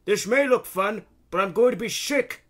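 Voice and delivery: high, loud put-on old man's voice; Grandpa Jack shouting. A narrator's reading. Queasy grandpa to the conductress, shouting. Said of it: "Grandpa Jack shouted".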